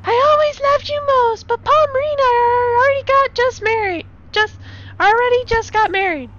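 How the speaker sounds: high falsetto lady voice; high, earnest girlfriend voice; [name]'s high voice. Acted. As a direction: pitch way up as the bride.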